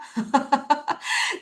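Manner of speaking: cheerful laugh. Real, not acted. Teacher joking with students about her bright expression, laughing. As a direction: laugh after your own joke.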